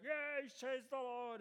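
high, drawn-out mock scream. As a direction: shriek it out like a woman yelling from the back. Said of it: "She just started screaming from the back".

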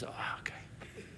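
soft, slow whispered oh okay. Retold or imitated. Installer backing down to the pastor, whispered. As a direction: breathe it out sheepishly. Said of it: "he goes".

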